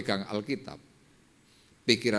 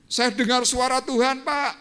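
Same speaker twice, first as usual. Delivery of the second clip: excited exclaiming; 7 dB louder than usual. Retold or imitated. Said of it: "Saya bilang".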